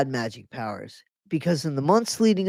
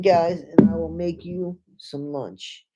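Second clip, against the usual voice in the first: ordinary delivery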